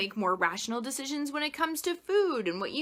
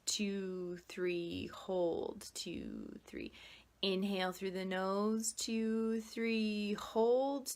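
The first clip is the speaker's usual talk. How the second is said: soft, quiet counting voice with whispered holds; guided breathing; 5 dB quieter than usual. Acted. Coach leading viewers through box breathing, soft guided voice.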